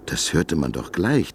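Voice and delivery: ordinary delivery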